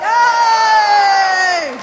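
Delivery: excited cheer. Real, not acted. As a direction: cheer the finish. Cheering after the pitch.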